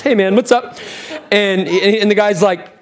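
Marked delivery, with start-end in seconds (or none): 0.0-0.8 laid-back buddy greeting, pitched up; God/character. Acted. Imagined God greeting an angry new arrival, casual and higher-pitched. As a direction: greet him like an easygoing pal. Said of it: "how I think God talks".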